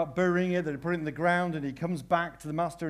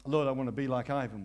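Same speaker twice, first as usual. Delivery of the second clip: ordinary talk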